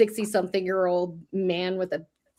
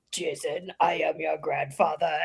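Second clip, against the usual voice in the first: a hint of Vader, low and grave; slightly lowered mock Vader line; lightly done Darth Vader voice; 5 semitones lower, 5 dB quieter than usual. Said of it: "he does that thing, but he says".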